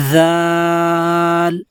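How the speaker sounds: "ذال" long and slow, tongue between the teeth; tongue out, drawn. Shown as a demonstration. Teacher showing the tongue position: a long "ذال". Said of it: "تھوڑی سی زبان باہر نکال کر".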